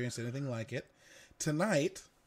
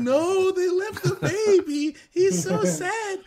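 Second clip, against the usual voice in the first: put-on high, teary child's wail, much louder, slowed; sobbing toddler; 8 dB louder, slower than usual. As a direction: imitate a heartbroken little girl crying over the reindeer. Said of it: "started fucking sobbing".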